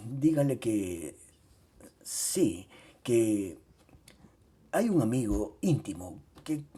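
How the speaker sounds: halting, quiet stammer with pauses. A narrator's reading. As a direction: stumble over the words, barely able to speak. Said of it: "balbucí".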